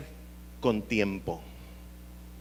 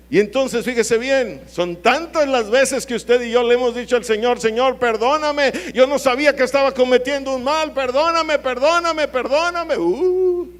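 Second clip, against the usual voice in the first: loud, high fervent preaching; preaching at full force; 10 semitones higher, 9 dB louder than usual. Acted. Preacher crying out to the congregation, full force. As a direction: hammer it home from the pulpit.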